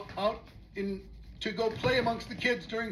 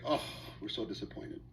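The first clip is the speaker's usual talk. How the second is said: low, soft mock disappointment; disappointed parents; 10 semitones lower, 9 dB quieter than usual. Parents to their child, mock disappointed and low. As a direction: sigh it out like let-down parents. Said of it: "And they're like".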